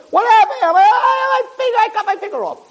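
mock panic, voice up high; retold panicked cry. Rabbi recalling his kitchen accident, high panicked cry. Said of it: "I was screaming".

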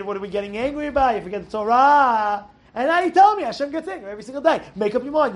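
usual speaking voice

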